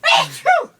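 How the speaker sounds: loud sudden sneeze; a big real sneeze; sneezing. Real, not acted. Sneezing fit.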